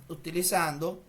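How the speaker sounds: normal talking voice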